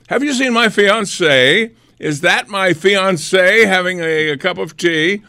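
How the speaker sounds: fiance-obsessed woman. Retold or imitated. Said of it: "made fun of a woman who was constantly asking about her fiance".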